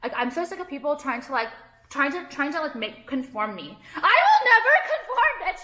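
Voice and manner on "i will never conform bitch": very high-pitched squeal of defiance; defiant outburst. Real, not acted. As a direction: burst out high, refusing to give in. Defiant outburst against pressure to conform.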